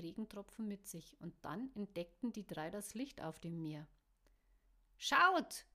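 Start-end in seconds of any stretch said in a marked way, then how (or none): 5.0-5.7 high, loud excited cry; calling out. A narrator's reading. Caro calling the others to look at the light. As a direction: call out to make them look. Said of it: "rief Caro und hielt an".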